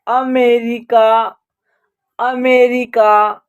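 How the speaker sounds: "अमेरिका" slowly sounded out, starting on a short 'uh'; teacher's slow, careful "अमेरिका", pause between repeats; short 'uh' opening. Shown as a demonstration. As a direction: model "अमेरिका" slowly, stretching the later syllables. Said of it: "ए का उच्चारण अ हो रहा".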